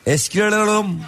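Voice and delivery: high sing-song junk call; melodic street vendor shout. Retold or imitated. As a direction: croon it like a street junk buyer. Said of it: "Eskici yapardım sana". (melodik eskici bağırışı)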